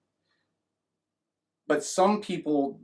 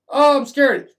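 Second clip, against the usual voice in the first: mock scared; 9 semitones higher, 9 dB louder, slower than usual. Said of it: "instead of going".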